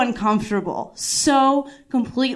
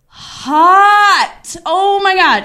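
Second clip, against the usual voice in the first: higher, louder gushing over him; swooning excitement; 7 semitones higher, 5 dB louder, much slower than usual. Real, not acted. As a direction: gush like you just saw someone gorgeous. Swooning over the helpful guy.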